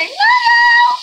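very high, loud squealed love you; bubbly high-pitched goodbye shout; squealed sign-off. Real, not acted. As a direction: squeal it out to the fans. Vlog sign-off to fans.

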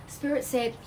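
ordinary talk

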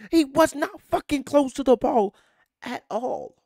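loud, high angry rebuttal; outraged. Real, not acted. Fan refuting officials' penalty excuse to viewers, outraged. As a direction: shout down the excuse.

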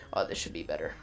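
ordinary talk

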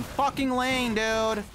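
high, drawn-out complaint; exasperated outburst. Real, not acted. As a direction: wail it in disbelief. Opponent is back in lane already.

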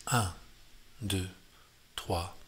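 evenly spaced musician's count; count-in at tempo. Shown as a demonstration. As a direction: count the beats steadily, a pause after each. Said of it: "Je vous le fais un petit peu au tempo". (décompte régulier au tempo)

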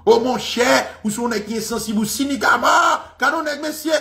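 high, rising reproach; hammed-up indignant protest, pitched high; mock offended critic. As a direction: imitate someone scolding a friend. Acts out critics calling him cynical.

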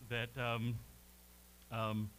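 ordinary delivery

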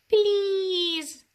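high, pleading little squeak; tiny mouse voice. Acted.

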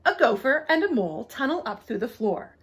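lively, sing-song storybook reading; bouncy read-aloud with big pitch swings; story narration. A narrator's reading. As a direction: read a rhyming animal tale to children.

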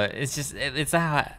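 ordinary delivery

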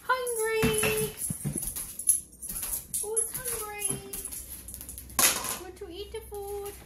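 high pet-talk voice. Real, not acted. Owner teases the hungry dog, high baby talk.

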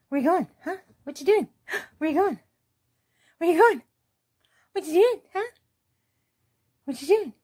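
high pet voice. Real, not acted. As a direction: coo after the dog. Baby-talk to dog.

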